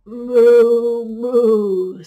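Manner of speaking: long, very loud moo; cow mooing. Acted. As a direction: moo at full volume like a cow. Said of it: "said the cow".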